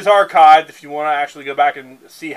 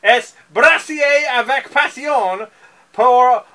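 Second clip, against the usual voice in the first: hammed-up French accent, higher and louder, a laugh at the start; mock French accent; 7 semitones higher, 6 dB louder than usual. Reading more of the French label to the co-host in a hammed-up French accent. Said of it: "There is French on here".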